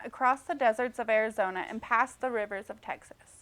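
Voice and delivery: plain reading voice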